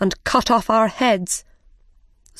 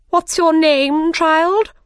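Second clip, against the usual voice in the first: high, loud, slow demand; imperious Queen; 9 semitones higher, 5 dB louder, slower than usual. A narrator's reading. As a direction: question the child as a bossy Queen. Queen demands Alice's name.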